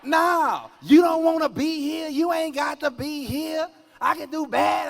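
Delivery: high, loud wife yelling; wife shouting. Retold or imitated. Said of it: "I just feel like she doing it".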